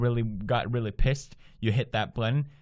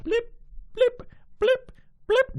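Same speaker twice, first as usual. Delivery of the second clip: very high squeaky blips; high-pitched cartoon blip sounds; bobbing blip noise; slower than usual. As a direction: imitate a character bobbing up and down. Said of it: "going up and down".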